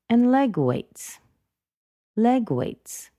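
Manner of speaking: "leg" high, "weights" falling; emphasis on LEG. Shown as a demonstration. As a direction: punch "leg" and drop on "weights". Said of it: "I really emphasized leg weights".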